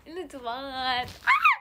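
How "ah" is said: sudden high, loud squeal of laughter; laughing shriek. Real, not acted. Burst of laughter at something cute.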